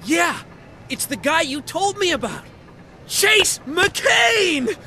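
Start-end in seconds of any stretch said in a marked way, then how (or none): 3.1-4.8 high, proud shout of the name; excited announcement. Acted. Officer introduces newcomer to desk clerk, excited. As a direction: present him like a celebrity.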